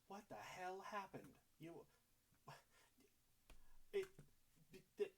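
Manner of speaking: high, stammering alarm; shocked disbelief. Acted. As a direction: voice shoots up in stunned questioning. Gustav reacts shocked to burning house.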